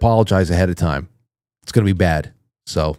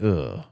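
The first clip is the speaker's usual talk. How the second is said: disgusted ugh; 7 semitones lower, 9 dB quieter than usual. Pretend. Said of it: "someone like me going".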